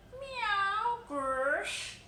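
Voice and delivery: high, loud yowling meow; horrid cat noises. A narrator's reading. Simpkin yowling at the sleeping tailor, high and loud. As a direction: yowl like a cat at night. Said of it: "made strange, horrid noises".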